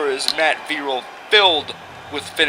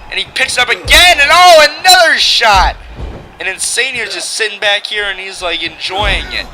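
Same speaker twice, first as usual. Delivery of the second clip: wrestling commentator; 4 semitones higher, 6 dB louder than usual. Acted. Calls the match play-by-play.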